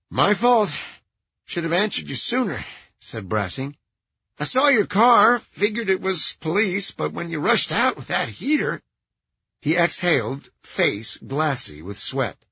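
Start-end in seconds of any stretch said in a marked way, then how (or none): 0.1-8.8 high nasal, sheepish and nervous; apologetic, high and nasal. A narrator's reading. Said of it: "High-pitched, nasal voice".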